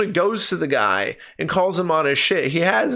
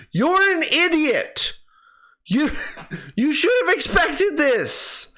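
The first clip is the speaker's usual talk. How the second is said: exasperated laughing rant; 10 semitones higher, slower than usual. Real, not acted. Ranting at the film's hero.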